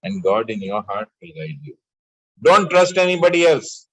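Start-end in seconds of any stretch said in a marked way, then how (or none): 2.4-3.9 loud, high, forceful command; ringing exhortation; emphatic warning. Real, not acted. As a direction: raise your voice to drive the warning home. Teacher urgently commands his listeners.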